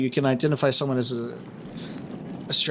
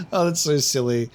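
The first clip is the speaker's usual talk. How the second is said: ordinary delivery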